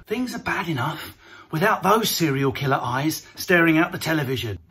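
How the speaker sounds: normal talking voice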